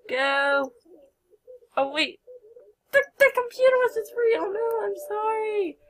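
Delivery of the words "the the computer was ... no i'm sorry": much higher, fast, flustered surprise; startled squeal. Real, not acted. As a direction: caught off guard, squeak it out. Computer suddenly taken, surprised.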